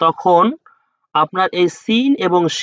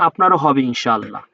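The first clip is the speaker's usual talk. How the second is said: ordinary talk, nothing marked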